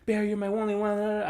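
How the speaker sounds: high mock poem recital; imitated crazy rambling. Imitating her reciting at the party, high mock rambling. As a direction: chant it in a high put-on voice. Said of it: "She starts saying this crazy shit".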